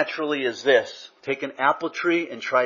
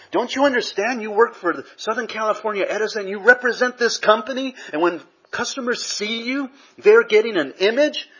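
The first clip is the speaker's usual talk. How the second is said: scolding boss; 9 semitones higher, 4 dB louder than usual. Retold or imitated. Boss scolding employee in story.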